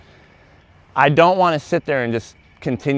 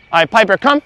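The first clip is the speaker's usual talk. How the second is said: raised call of the dog's name; firm recall; 6 semitones higher, 5 dB louder than usual. Real, not acted. Trainer calling dog to come, raised. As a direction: call her in with energy.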